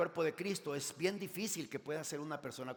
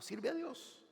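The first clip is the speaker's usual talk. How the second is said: much higher, ringing command; exhorting command; 9 semitones higher, slower than usual. Acted. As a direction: call it out to the whole church. Preacher repeats command. (orden exclamada, mucho más aguda)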